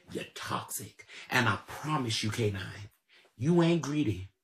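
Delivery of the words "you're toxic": breathy under-the-breath whisper; muttered whisper aside. Real, not acted. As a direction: drop to a whisper to twist the knife. Muttered aside after calling toxic.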